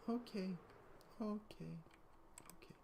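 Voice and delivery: high okays fading to a whisper. Real, not acted. Soothing baby, fading.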